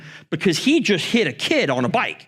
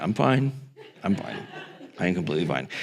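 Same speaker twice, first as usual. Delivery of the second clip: much lower and softer, sinking away; quiet guilty mumble; 9 semitones lower, 6 dB quieter, faster than usual. Retold or imitated. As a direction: say it small and ashamed. Kid answering mom guiltily.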